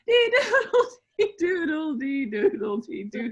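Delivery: singing the rooster refrain while giggling; laughing through the song. Acted. Asked to sing the rooster part, giggling through it. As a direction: sing it but crack up. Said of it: "you gotta do the rooster part".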